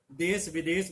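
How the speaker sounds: देश विदेश with a dental स, as देस विदेस; jarring देस विदेस; wrong dental s. Shown as a demonstration. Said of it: "देश विदेश बोलते हैं".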